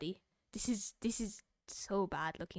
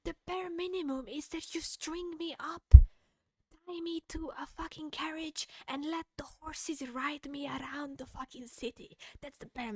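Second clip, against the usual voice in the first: pitched up, heated and emphatic; heated rant; 7 semitones higher, 9 dB louder than usual. Real, not acted. Streamer ranting to chat about what friends deserve. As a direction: escalate it like a passionate rant.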